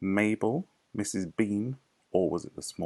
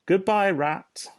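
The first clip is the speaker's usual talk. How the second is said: higher, louder, slow farewell; calling out; 12 semitones higher, 7 dB louder, slower than usual. Acted. Foxes calling goodbye as they run, louder and higher. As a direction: call back over your shoulder. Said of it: "they called out".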